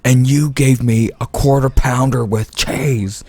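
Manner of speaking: lightly mocked angry customer. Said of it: "she's like".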